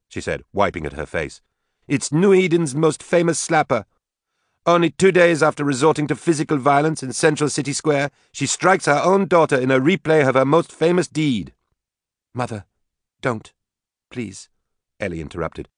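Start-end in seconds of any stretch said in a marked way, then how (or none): 1.9-11.5 high, mock proclamation; stung, jeering Katie. A narrator's reading. Slapped daughter mocks her mother before the women, jeering. 12.3-14.5 soft, halting plea; fearful pleading. A narrator's reading. Bride pleads with mother not to slap again, fearful. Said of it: "fearing that Nicole would slap Katie again".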